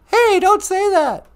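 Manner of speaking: put-on high, squeaky child protesting; a little kid yelling back at the host. High child voice scolding the host.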